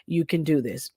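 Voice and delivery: hushed calm pep talk to yourself; calm inner voice. Retold or imitated. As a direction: settle yourself gently. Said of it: "there was a calm in me".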